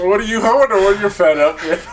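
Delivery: ordinary talk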